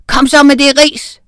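high, loud, furious order; teacher losing his temper; very angry. A narrator's reading. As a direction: yell the demand at the child. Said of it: "lød herr Dummesen meget vred".

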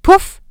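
high, loud "Puff"; magic puff. A narrator's reading. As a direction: pop like a spell going off. Magic sound effect.

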